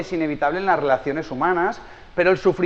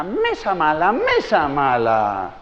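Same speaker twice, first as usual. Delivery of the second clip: slow, lightly voiced childish chant; a little girl copying her mum; child scolding table; much slower than usual. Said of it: "y empieza". (niña repitiendo a su madre, regaño infantil lento)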